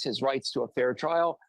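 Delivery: normal talking voice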